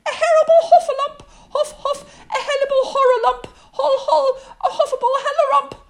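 frantic, very high squeal; tongue-tangled panic, loud and squeaky; Piglet crying out. A narrator's reading. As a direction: gabble the alarm as a terrified little pig. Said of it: "still crying out".

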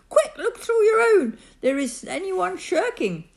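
insistent, high-pitched calling; calling back. A narrator's reading. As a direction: press the mayor with anxious questions. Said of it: "But Horton called back".

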